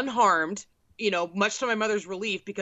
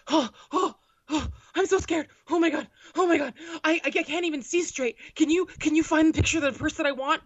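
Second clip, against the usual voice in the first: higher, breathless mock-panic voice; mock panicked mom; 6 semitones higher than usual. Daughter imitating her panicking mom in a stairwell, mock-frightened. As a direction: ham up your scared mother. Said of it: "my mom is flipping the fuck out".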